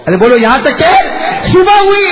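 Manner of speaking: high, loud rousing cry; preacher calls the audience to respond; preaching at full force. Acted. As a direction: rouse the crowd to answer.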